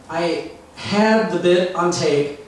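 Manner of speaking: ordinary talk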